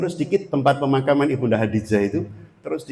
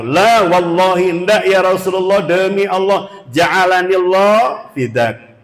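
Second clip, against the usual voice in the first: higher and louder, slow vehement oath; earnest oath; 6 semitones higher, 7 dB louder, slower than usual. Retold or imitated. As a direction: swear it with all your heart. Youth swears oath earnestly.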